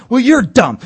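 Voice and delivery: mock shouted insult. Corporate neighbor trading insults, loud high retort. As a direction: snap it back loud. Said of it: "shouting match".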